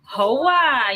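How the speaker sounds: "Hoa" swooping high and long; slang exclamation. Shown as a demonstration. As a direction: exclaim a drawn-out "Hoa", like a wow. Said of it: "You have to say it like".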